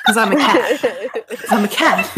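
cracking up mid-line; laughing through the words. Real, not acted. Laughing while joking in character.

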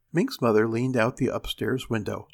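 usual speaking voice

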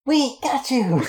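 high, muffled cry from far off; muffled shout. Acted. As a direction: shout it through a wall. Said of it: "a muffled".

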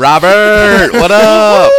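a playful call-out to a friend; loud shout-out. Acted. Calling out to the coworker Robert, loud and joking.

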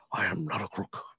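put-on low, quiet old president voice; Nixon impression. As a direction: say it like a cornered president.

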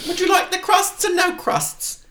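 shrill-high doting mother voice; high mummy voice. Acted. Parody mum offering crusts.

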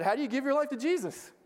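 high, earnest asking; eager question. Retold or imitated. Amir asks the intern eagerly, high earnest question. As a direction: pitch it up, keen to know.

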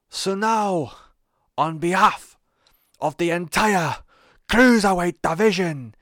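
put-on Geordie accent. Wrestler threatening Enzo on Raw, in a loud Geordie accent. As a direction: channel Neville's furious Geordie cadence, rising to a roar. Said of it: "I'm gonna do the accent".

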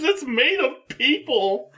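high-pitched mock scream without much volume; retold scream. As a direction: cry it out high, as an imitated horror scream. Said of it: "She just screams".

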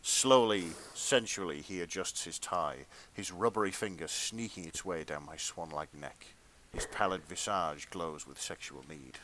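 measured, hushed-down storyteller read; dramatic fan fiction narration. A narrator's reading. Reader performing steamy fan fiction for friends, dramatic narration. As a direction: read it as earnest literary erotica.

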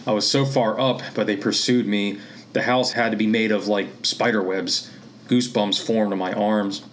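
ordinary delivery, nothing marked

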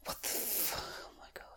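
hushed, breathy what-the-fuck; shocked whisper. Acted. Partner walks into a smoky house, whispered shock. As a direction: mutter it under your breath in shock.

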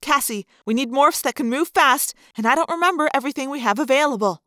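fast, pressing; hurried plea for a plan; urgent. Acted. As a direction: rush it out urgently. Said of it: "I said urgently".

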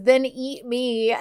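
slow, lightly flirty tease; flirty husband. Retold or imitated. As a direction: draw it out playfully. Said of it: "he finally says".

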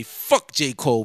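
ordinary talk, nothing marked

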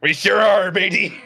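loud, hammy pirate growl. Pretend. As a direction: ham it up like a pirate. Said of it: "You sound like a pirate".

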